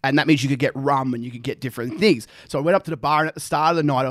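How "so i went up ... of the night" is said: usual speaking voice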